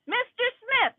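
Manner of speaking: high mocking call of the name; sarcastic judge. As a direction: lift the name high, talking down. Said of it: "Sarcastic people".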